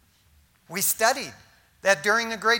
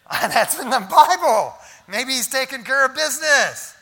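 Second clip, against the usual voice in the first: mocking taunt; 4 semitones higher, 6 dB louder than usual. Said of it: "He said".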